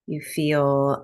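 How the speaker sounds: ordinary delivery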